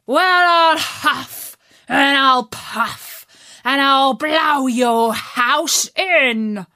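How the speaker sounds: wolf voice. Acted. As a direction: threaten slowly like a big bad wolf. Wolf threatens the pig.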